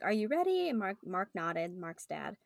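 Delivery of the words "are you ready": attendant asking. Acted. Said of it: "they're like".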